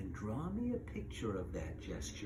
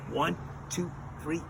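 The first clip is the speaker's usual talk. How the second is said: ordinary talk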